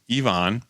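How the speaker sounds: Yvonne drawn out as ee-VAHN; careful correct pronunciation. Shown as a demonstration. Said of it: "saying it the correct way".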